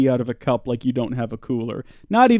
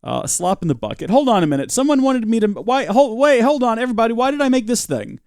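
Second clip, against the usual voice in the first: ordinary talk, nothing marked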